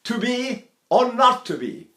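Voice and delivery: high, slow declaimed line; hammed-up Hamlet. Shown as a demonstration. Teacher playfully declaiming Hamlet. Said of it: "So I go".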